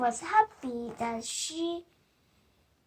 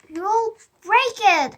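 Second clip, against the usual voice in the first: bossy warning; 5 semitones higher, 10 dB louder than usual. Acted. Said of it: "answered Sam".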